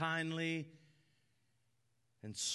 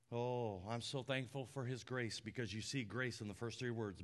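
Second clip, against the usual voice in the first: ordinary talk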